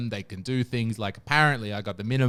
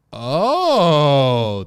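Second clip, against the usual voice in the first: loud, drawn-out "oh" of mock revelation; dawning realization; 3 semitones higher, 9 dB louder than usual. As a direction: let the penny drop, long and loud. Retold group suddenly understanding the bribe.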